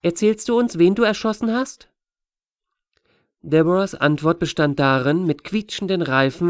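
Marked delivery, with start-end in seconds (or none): 0.0-1.9 put-on higher-pitched inquisitive girl; a kid pressing with a question; curious child. A narrator's reading. Astor asking Deborah whom she shot, curious child.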